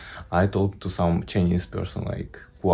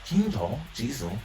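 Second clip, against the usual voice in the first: fake Chinese; 3 semitones higher, 6 dB quieter than usual. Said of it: "talks fake Chinese".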